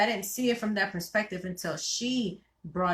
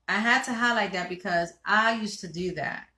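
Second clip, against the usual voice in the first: ordinary delivery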